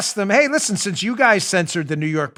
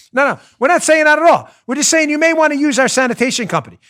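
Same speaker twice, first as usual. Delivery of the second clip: mock mob enforcer; 10 semitones higher, 7 dB louder than usual. Mob enforcer denying the threat.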